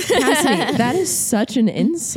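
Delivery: mock outrage through laughter; laughing protest. Real, not acted. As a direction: act insulted while laughing.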